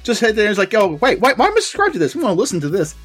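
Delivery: high, loud salesman energy; hyped-up pitch. Acted. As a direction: sell it hard and loud. Hyped plug as the Dapper Man.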